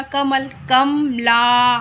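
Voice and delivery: very slow कमल, कमला, the long आ of कमला drawn out; कमल then कमला. Shown as a demonstration. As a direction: contrast कमल and कमला slowly. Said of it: "कमल और कमला के उच्चारण". (कमल, कमला बहुत धीरे)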